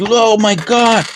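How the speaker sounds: a hammed-up, drawn-out Oh my God in a high voice; playful send-up of a YouTuber's amazement; mock gasp. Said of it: "he goes".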